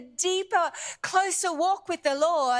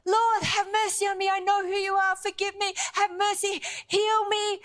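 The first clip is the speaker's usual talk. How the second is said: higher, urgent pleading prayer; king crying out to God for healing; crying out for mercy; 4 semitones higher than usual. Retold or imitated. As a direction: beg God earnestly, pitched up. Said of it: "cried out to God and said".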